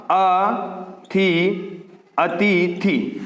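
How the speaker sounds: अतिथि very slowly, split into syllables. Shown as a demonstration. Teacher dictating the word's spelling to students, drawn-out syllables. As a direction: spell out अतिथि by sound, both i vowels short. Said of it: "ये शब्द ऐसे लिखा जाएगा".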